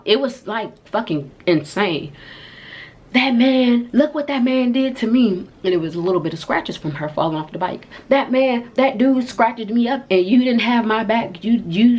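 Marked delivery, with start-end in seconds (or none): 3.1-5.5 high, loud mock outcry; hammed-up victim act, pitched up; wailed accusation. Friend's accusation retold. 8.1-12.0 high, loud mock rant; hammed-up, sputtering outcry; outraged accusation. Friend's accusation retold.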